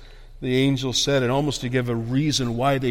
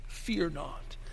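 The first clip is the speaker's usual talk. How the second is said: very soft, near-whispered; hushed repeat; 14 dB quieter than usual. Real, not acted. Preacher echoing the old King James wording, hushed. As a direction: let it fall almost to a whisper.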